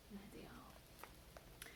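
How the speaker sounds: soft breathy whisper aside. Real, not acted. Reader whispering to the child over the picture. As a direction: whisper it like a shared secret.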